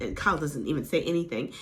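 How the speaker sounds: ordinary talk, nothing marked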